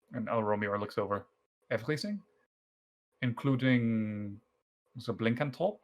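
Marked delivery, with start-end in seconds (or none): 1.7-5.8 put-on light German accent with a rising question; a hint of a German accent, surprised. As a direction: leichter deutscher Akzent. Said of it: "that's tough with a German accent".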